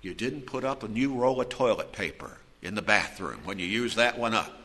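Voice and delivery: low, soft grumbling reproach; petty spousal complaint. Acted. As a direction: quietly accuse a spouse over a trifle.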